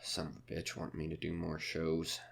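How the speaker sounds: quiet bitter muttering to himself; bitter grumble. Acted. Comic alone grumbling about the manager, quiet and bitter. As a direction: grumble it under your breath.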